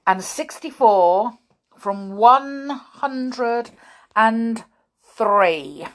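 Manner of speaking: loud, deliberate quizzing; stern schoolmaster. A narrator's reading. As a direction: fire the sum at a pupil, stretching the numbers. Grimm quizzing Peter.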